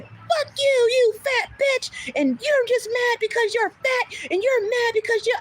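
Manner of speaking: mock screaming. Older guest insulting front desk worker, high mock screaming. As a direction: imitate an irate guest in a high, drawn-out mock yell. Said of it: "they have screaming matches with guests".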